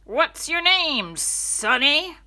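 big, slow, booming bus driver; loud driver. Acted. Gruff driver booming down at the kids from the steps, loud and slow.

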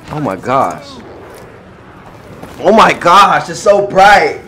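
ordinary talk

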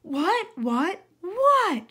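rising to a very high, loud exasperated shout; a furious fox yelling what. Acted. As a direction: burst out, patience gone. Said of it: "shouted the fox".

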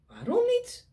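high, bright rabbit question; high rabbit asking why; curious rabbit. Acted. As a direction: ask it like a nosy little rabbit. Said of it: "Vraagt Konijn".

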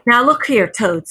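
high, loud scolding opener; sharp. A narrator's reading. Rat confronting Toad behind them, sharp rebuke. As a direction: pull someone up sharply. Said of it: "said the rat sharply".

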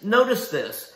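normal talking voice

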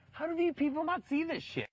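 high-pitched, exasperated disbelief; retold incredulity. Retelling to friends how nobody sees Mike's antics, incredulous.

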